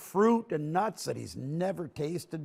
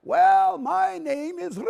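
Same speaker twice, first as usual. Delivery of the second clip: much higher and louder, slow gnome elder; old gnome voice; 13 semitones higher, 9 dB louder, slower than usual. Acted. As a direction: puff up like a proud tiny elder. Eldest gnome begins his name.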